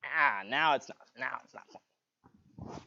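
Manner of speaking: slow, strangled near-whisper under a tight choke. Real, not acted. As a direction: squeeze the words out, barely voiced. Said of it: "I can hear a little bit of difference".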